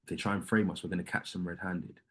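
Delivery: hushed and low, as if in secret; retold whisper. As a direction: keep it down so no one hears. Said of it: "I was whispering to her".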